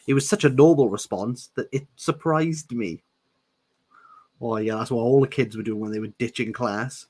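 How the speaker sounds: ordinary talk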